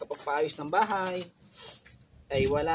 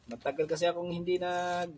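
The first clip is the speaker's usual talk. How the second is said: ordinary talk, nothing marked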